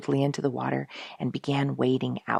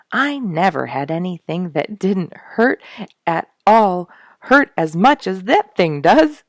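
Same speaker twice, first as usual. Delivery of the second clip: giggling; 4 semitones higher, 8 dB louder than usual. A narrator's reading. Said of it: "giggled Duke".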